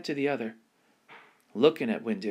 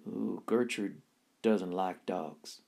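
lower, slow, soft worried voice; hesitant clerk; 4 semitones lower, 4 dB quieter, slower than usual. Acted. Shy clerk warning the girl about the owner, hesitant. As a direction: draw it out, uneasy. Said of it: "said Otis".

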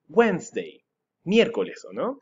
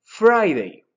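"Friday" projected much louder, higher, with a falling end; loud model word; 6 semitones higher, 9 dB louder than usual. Shown as a demonstration. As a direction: call out "Friday" for the class to repeat. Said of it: "next we have Friday".

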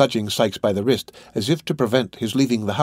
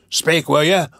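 louder, higher rough order; angry demand; 5 semitones higher, 6 dB louder than usual. A narrator's reading. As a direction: demand an answer angrily.

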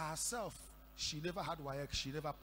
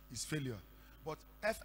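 ordinary talk, nothing marked

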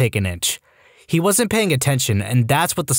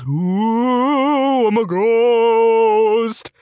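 high, drawn-out mock ghost wail; spooky ghost voice hammed up for laughs; ridiculous ghost noises; 8 semitones higher, much slower than usual. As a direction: wail it slowly like a silly ghost. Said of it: "Plenty of ridiculous ... noises".

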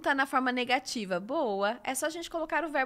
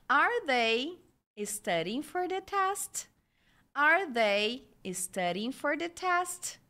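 slow "Are they", higher rising "studying for the test?"; question intonation; 3 semitones higher, slower than usual. Shown as a demonstration. Teacher models English question intonation for students, slow and lifted. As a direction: ask "Are they studying for the test?" slowly, lifting the end. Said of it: "Prestem atenção na entonação também".

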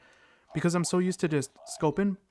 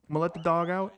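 ordinary talk, nothing marked

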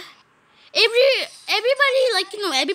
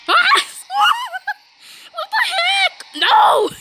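very high, loud screamed protest; startled shriek; 4 dB louder than usual. Real, not acted. Player yelling at the game, startled shriek. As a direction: shriek in shock, breaking into laughter.

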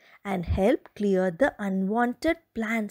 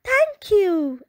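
happy fox thanks; 7 semitones higher, 5 dB louder than usual. Acted. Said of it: "Happy fox Fiona howled".

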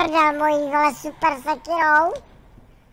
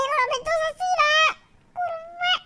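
startled yelp; 14 semitones higher than usual. Real, not acted. Game panic, screen obscured.

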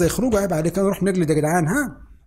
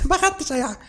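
higher, with a laugh through it; laughing disbelief; 8 semitones higher than usual. Real, not acted.

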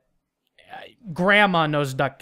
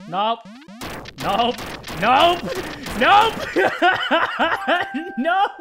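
escalating nope; 8 semitones higher, slower than usual. Real, not acted. Rising refusals.

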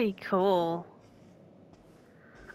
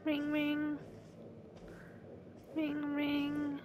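soft phone ring; 5 semitones higher, 15 dB quieter, much slower than usual. Pretend. Imitates phone ringing softly.

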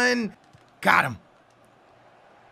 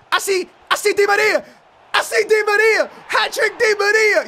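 high, loud, thrilled call; hat-trick celebration yell; excited goal shout; 8 semitones higher, 4 dB louder than usual. Real, not acted. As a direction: shout the scorer's name in triumph.

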